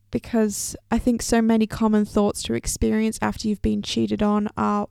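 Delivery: ordinary talk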